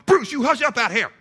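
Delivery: high-pitched back-porch holler; yell. Retold or imitated. Man on the back porch to his barking dog, yell. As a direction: re-enact yelling at the dog. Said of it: "on the back porch and yell".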